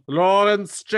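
loud, high mock Scottish catchphrase; hammed-up Scottish self-announcement; Lawrence Chaney. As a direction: belt out the name as a comic imitation. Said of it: "Lawrence, say something funny".

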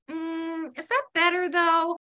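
high, hesitant skepticism; doubtful. Retold or imitated. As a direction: hum doubtfully, then gently question it. Church friends' doubtful retort, retold.

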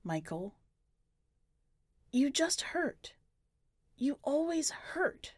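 high, slow and hurt; a boy aching as he blames his brother; boy accusing the puppet of his dead brother; hurt accusation. Acted.

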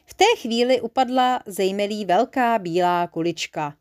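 plain reading voice

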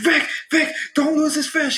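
high frantic dad voice; freaking-out dad. Retold or imitated. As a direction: yelp it like a panicked father. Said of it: "He was freaking out".

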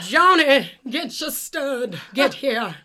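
loud, high hammed-up call to Johnny; hammed-up frontier shout, slow on the stud line; mock cowboy summons. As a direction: yell him in like a western dispatcher. Mock calling Johnny to ride.

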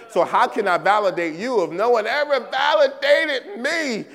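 acted cry of hurt indignation; indignant protest. Voices the unvalidated person's protest.

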